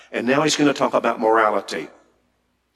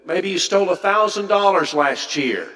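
higher, louder sarcastic mock advice; mocking gradualism; 6 semitones higher, 3 dB louder than usual. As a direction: ham up the silly step-by-step plan. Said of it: "That's not what he said".

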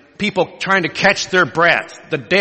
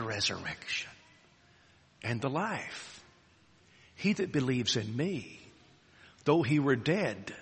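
solemn hush; 10 dB quieter, slower than usual. Acted. Jesus' words, slow and hushed.

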